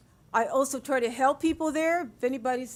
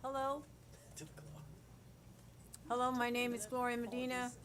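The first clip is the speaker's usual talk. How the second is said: far off from the microphone, barely picked up; faint, mic not on; 15 dB quieter than usual. Real, not acted. Speaker at council podium before mic is on, faint and distant. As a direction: speak away from a dead mic. Mic off, she starts again.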